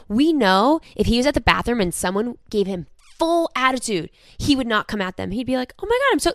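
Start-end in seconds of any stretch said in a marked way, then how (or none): 5.8-6.3 much higher, gushing apologetic friend; kind, gentle apology. Retold or imitated. Kevin apologising to someone at the bar bathroom, much higher and gentle. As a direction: play Kevin melting into a sorry, much higher. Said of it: "he would be so kind and so gentle with them".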